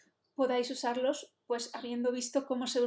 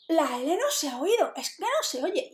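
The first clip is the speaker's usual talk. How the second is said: indignant students; 4 semitones higher, 5 dB louder than usual. Retold or imitated. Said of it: "a veces estábamos indignadísimos".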